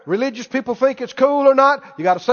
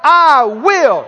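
bold, raised-pitch defiant declaration; emphatic preacher's rebuttal, building louder; defiant answer; 3 semitones higher, 5 dB louder than usual. Acted. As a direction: fire back at the devil with total conviction. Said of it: "You say".

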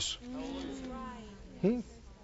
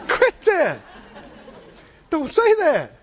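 mock scolding; 7 semitones higher, slower than usual. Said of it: "And you wanna go".

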